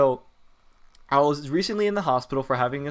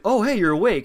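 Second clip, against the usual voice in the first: higher-pitched, upbeat hello; chirpy greeting; 7 semitones higher, 4 dB louder than usual. Retold or imitated. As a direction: greet a waking patient brightly, pitched up. Ronald greets the waking patient.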